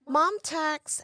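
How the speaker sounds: high singsong Mom tax; teasing demand for a bite; playful mom. Retold or imitated. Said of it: "she would say".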